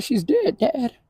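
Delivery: soft, high, breaking voice; small high voice close to crying; tearful. Real, not acted. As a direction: barely get it out through grief. Retelling breaking news to father.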